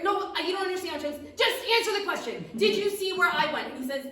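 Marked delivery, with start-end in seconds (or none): 0.0-2.4 raised, loud, impatient voice; exasperated. Acted. Witch exasperated with confused critic. 2.6-3.7 loud, high, insistent question; exasperated repeat. Acted.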